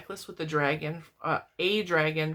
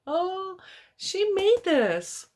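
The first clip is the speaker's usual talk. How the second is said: high, loud, stretched-out gush; gushing delight; 13 semitones higher, 5 dB louder, much slower than usual. Real, not acted. Vlogger gushing to viewers over a handmade gift, high and delighted.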